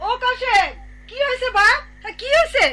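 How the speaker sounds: high, alarmed call to a son; worried mother asking what the row is. Acted. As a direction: call from another room, pitched well up. Mother calls out hearing the row.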